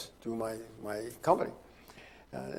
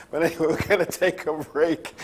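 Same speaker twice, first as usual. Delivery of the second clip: laughing through the break call, higher and louder; 5 semitones higher, 5 dB louder than usual. Real, not acted. Host sending the show to break, laughing. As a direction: try to announce the break while cracking up.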